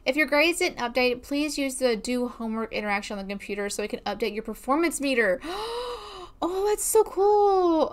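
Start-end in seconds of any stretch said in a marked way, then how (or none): 5.4-7.9 delighted gasp. Real, not acted. Daughter finished her lecture.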